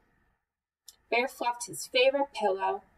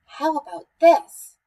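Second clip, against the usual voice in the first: slow, high, muffled voice, pushed louder; from under pillow; 6 semitones higher, 10 dB louder than usual. A narrator's reading. Mouse testing Bear from under his pillow, muffled. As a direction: strain to speak through a pillow. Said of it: "from under his pillow".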